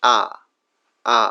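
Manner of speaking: a drawn-out open আ, mouth spread; demo vowel sound. Shown as a demonstration. Teacher modelling আ for students. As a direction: say আ slowly and clearly as a model. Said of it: "এই সাউন্ডটাকে আমরা যখন উচ্চারণ করবো".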